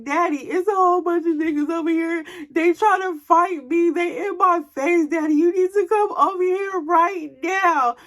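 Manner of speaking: acted-out tearful, whiny plea; crying to daddy. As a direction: plead for rescue like a tearful daughter. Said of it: "I'm just crying, I'm frustrated".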